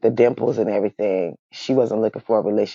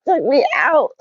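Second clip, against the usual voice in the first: high laugh spilling into words; 4 dB louder than usual. Real, not acted. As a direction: squeal it out laughing. Laughing fit.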